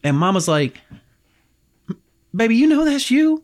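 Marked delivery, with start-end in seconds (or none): 2.3-3.4 high, louder motherly plea; mother urging her son to confess, high and pleading; mama voice. Retold or imitated.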